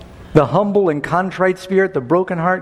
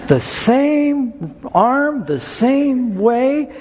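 "same" drawn out very slowly and pitched high in both phrases; slow, high, marvelling emphasis on "same"; stress on same; 8 semitones higher, much slower than usual. Real, not acted. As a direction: linger on each "same" in wonder. Marvelling at the coincidence.

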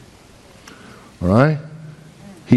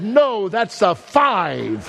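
teacher's correction; 8 semitones higher, much slower than usual. Retold or imitated. Teacher correcting child in story.

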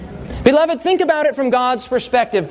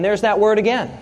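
ordinary talk, nothing marked

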